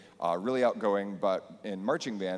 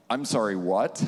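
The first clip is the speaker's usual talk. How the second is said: louder, startled double-take; disbelief; 2 semitones higher, 6 dB louder than usual. Retold or imitated. Teacher hearing student plans to march, startled disbelief. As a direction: catch yourself in disbelief.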